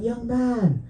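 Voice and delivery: put-on elderly lady. Elderly lady going to the crying man, mock gentle scolding. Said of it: "this elderly lady was going to him".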